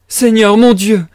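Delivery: loud, high exclamation of alarm; shocked outcry; crying out. A narrator's reading. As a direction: cry out to heaven, high and loud. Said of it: "S'écria Pulcheria Alexandrovna".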